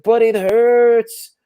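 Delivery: high, strained pained wail; man in pain. Retold or imitated. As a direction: whine it like a patient in agony. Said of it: "He's like".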